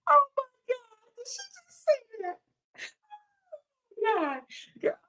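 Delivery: high, shocked gasping disbelief. Real, not acted. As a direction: squeal it out, stunned. Shocked reaction to comment.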